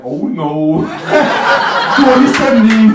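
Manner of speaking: high, loud mock panic; a Swede panics about the year, high and loud; alarmed Swede. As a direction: shriek it like a frightened Swede. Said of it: "The Swede's like".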